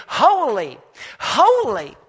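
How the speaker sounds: rising, ringing loud cry of holy; excited heavenly creatures calling out. A narrator's reading. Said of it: "they begin calling out and they say".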